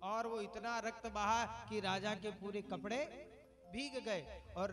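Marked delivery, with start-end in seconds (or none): none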